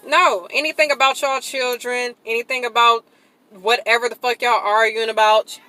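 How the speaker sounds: usual speaking voice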